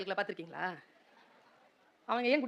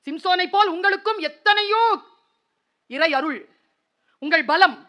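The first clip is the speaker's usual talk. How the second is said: high, loud pulpit appeal; preaching at full force; 8 semitones higher, 14 dB louder than usual. Acted. Preacher appeals to the children about their mistakes, high and loud.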